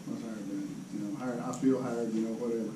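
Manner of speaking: a soft private wondering; quiet musing. Retold or imitated. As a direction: muse under the breath about a friend. Musing while mowing.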